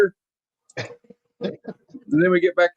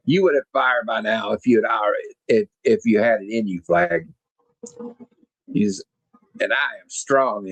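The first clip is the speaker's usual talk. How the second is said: sneering villain; 6 semitones lower than usual. Retold or imitated. Cobra Commander mocks General Flagg as predictable, lower smug villain voice. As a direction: purr the insults like a bored mastermind.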